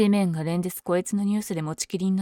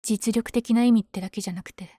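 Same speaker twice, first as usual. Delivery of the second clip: ordinary talk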